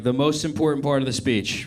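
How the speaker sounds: normal talking voice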